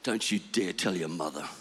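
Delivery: low, soft mock-stern whisper; father whispering to his son on the beach. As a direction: hush it like a secret joke. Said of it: "I whispered those words".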